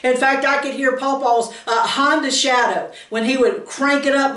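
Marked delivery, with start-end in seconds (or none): none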